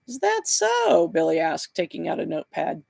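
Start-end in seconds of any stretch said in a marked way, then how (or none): 0.1-1.1 high, slow, drawn-out disbelief; sceptical. A narrator's reading. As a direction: humour him with a stretched-out question. Detective doubts the angel story.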